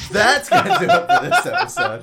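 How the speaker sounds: high, loud, laughing announcement; insistent loud sign-off. Real, not acted. Host closing the episode over a co-host, loud and laughing. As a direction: push the outro over the interruption.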